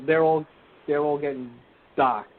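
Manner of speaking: ordinary talk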